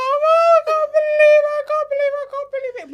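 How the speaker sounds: loud, high-pitched exaggerated wail; mock crying. Imitating herself crying on mum, hammed-up wail. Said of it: "And I started crying".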